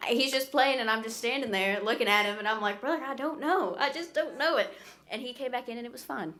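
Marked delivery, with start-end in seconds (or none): 2.8-4.7 retold protest. Retold protest to singer on stage.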